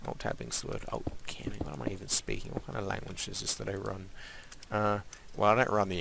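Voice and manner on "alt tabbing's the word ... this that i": whispering. Real, not acted. Muttering to himself while playing.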